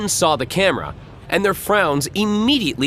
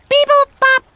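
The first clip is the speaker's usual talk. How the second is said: much higher, louder robot bleeps; robot beeps; 9 dB louder than usual. Acted. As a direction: chirp it like a little robot. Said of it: "which is Nindroid for".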